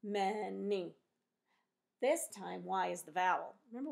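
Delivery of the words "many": "Many" stretched into two loud parts, men-ee; split into syllables. Shown as a demonstration. Teacher showing many has two syllables, split into syllables. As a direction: break "Many" clearly after the first syllable. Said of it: "I'm thinking it has two syllables".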